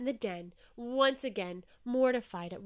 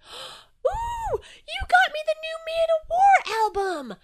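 very high, loud squeal; psyched scream; 13 semitones higher, 12 dB louder, slower than usual. A narrator's reading. Jellyfish getting the new album, psyched screaming. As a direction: shriek it like a delighted fan. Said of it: "screamed Jellyfish, psyched as ever".

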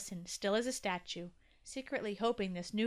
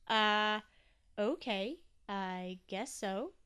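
hesitant, halting agreement; unsure; much slower than usual. A narrator's reading. Toby accepting his job reluctantly, unsure. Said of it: "still wasn't quite sure".

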